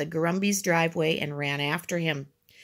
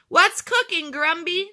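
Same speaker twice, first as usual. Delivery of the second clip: high, loud neighborly holler; raised-pitch curious shout; calling out; 11 semitones higher, 4 dB louder than usual. A narrator's reading. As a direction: call out a question across the yard. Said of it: "someone called out".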